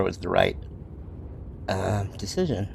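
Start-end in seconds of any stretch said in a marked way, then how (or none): none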